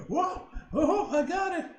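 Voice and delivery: loud thrilled whoop; excited shout. Real, not acted. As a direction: burst out cheering. Excited win in game.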